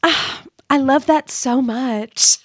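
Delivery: slow, drawn-out delighted coo; delighted actress. Retold or imitated. Actress hearing about the online impression, slow delighted coo. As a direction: stretch every word like a charmed star. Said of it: "she looks at me and goes".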